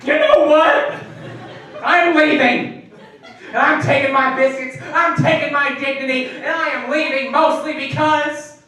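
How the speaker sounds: loud, high mock outrage; indignant storming out. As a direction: announce your exit with wounded pride. Storms out on the friend.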